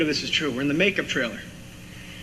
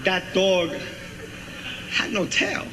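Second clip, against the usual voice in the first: lightly voiced Walken, pauses stretched; Walken impression. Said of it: "Walken goes".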